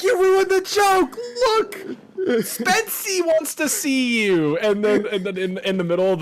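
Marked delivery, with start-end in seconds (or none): 0.0-4.6 high, loud, slow trickster whine; high cartoon imp complaining; indignant squeaky imp. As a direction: scold them in a squeaky imp voice. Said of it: "he's like".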